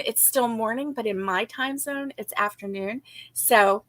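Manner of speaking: normal talking voice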